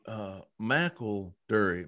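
"McElderry" drawn out slowly, every syllable spaced; slow careful pronunciation. Retold or imitated. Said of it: "told me how to pronounce it".

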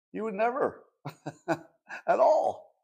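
high, slow, breaking into a laugh; laughing insistence. Real, not acted. Commentator insisting nobody would skip Hitchcock, laughing in disbelief. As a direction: laugh midway through an incredulous claim.

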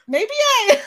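high giggly mock thought; laughing imitation. Fan voicing Tiffany's guilty thought, high and giggling. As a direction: let the laugh break through the line. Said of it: "she was thinking".

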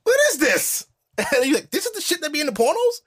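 high, squealing disgust; grossed-out boy. Retold or imitated. Shocked boy reacts to his first time. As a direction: recoil like a shocked teen.

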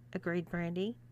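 ordinary delivery, nothing marked

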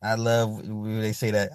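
usual speaking voice

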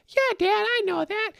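high, whiny child voice, mocking; whiny kid. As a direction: play the bored kid brushing off dad. Son answering dad, mocked.

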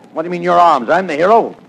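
normal talking voice

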